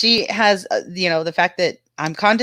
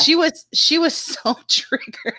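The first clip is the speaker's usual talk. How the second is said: laughing; 6 semitones higher, slower than usual. Real, not acted. Laughing while retelling rival's meltdown.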